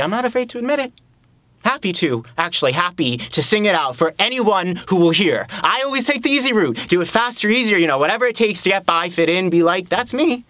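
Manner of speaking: high, forceful self-accusing rant; defiant confession. Acted. Man confesses his shortcuts to Abby, defiant and worked up.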